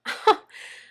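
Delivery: breathy scornful laugh. A narrator's reading. Anna laughs at Leslie, scornful. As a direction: laugh at the idea. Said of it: "Anna laughed".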